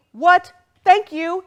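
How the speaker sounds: high, loud what of disbelief; surprised. Real, not acted. Host thanking a surprise donor, astonished. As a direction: genuinely caught off guard.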